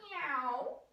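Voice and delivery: playful angry-cat noise; angry cat meow. Shown as a demonstration. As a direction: meow like a cross cat, for the baby. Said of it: "angry cat".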